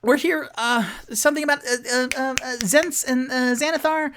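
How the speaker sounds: high, loud, stammering panic; Charlie Day impression. Tipsy insisting to the halfling woman, high and frantic. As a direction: babble it out, tripping on every word. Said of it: "his best Charlie Day impression".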